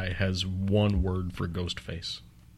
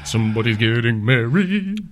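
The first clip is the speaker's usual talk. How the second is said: mocking, louder teasing repeat; teasing singsong; 2 semitones higher, 5 dB louder than usual. Real, not acted. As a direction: tease him in a singsong. Teasing chant about the wedding.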